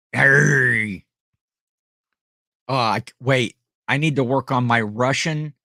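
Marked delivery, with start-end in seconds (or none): 0.1-1.0 loud, drawn-out pirate growl. Acted. Streamer going pirate for the ship captain joke, pirate growl. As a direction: let out a hammed-up pirate cry. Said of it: "we're going pirate".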